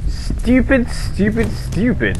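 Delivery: high squeaky repeated frustration; frustrated. Real, not acted. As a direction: mutter it high, rising then falling. Frustrated at game.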